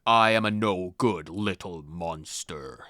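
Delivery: low, slow possessed drone; possessed monotone. Acted. Possessed boy echoes the ghost, low slow drone. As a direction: repeat the words like a puppet.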